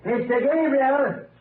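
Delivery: high drawn-out call. Acted. Searcher calling across the clearing, long call. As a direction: call out to someone far away.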